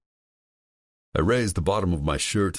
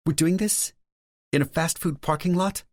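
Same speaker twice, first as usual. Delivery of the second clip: higher, lightly voiced woman; female character voice. A narrator's reading. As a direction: play Lauren, higher pitch.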